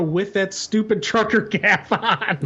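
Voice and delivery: laughing through the words; amused laughing. Real, not acted. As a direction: break into a laugh while mocking it. Mocks a character's cap, laughing.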